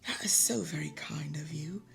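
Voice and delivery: put-on soft, low French-accented reply; quiet, courteous French accent; gracious French accent. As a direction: imitate a gracious Frenchwoman, hushed. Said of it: "she very graciously said".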